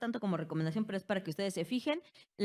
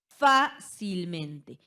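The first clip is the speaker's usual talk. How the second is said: "fácilmente" muy lento, con FÁ fuerte y larga; énfasis en la sílaba tónica; 7 dB louder, much slower than usual. Shown as a demonstration. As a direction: golpea el FÁ de "fácilmente" y separa el resto. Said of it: "antepenúltima y ante". ("fácilmente" silabeado: FÁ-cil-mente)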